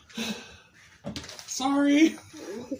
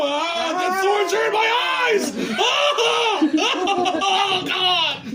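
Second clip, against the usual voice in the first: laughing mock pain; 4 semitones higher, 3 dB louder than usual. Thorns in eyes, mock pain.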